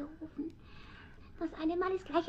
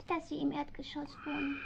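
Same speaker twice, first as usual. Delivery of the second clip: whisper; 2 semitones lower, 7 dB quieter than usual. Acted. Cat muses on the flat.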